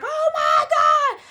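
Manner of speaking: high, loud, drawn-out cry; mock panicked scream. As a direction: scream it slowly like a mother in labor. Client panics as baby crowns.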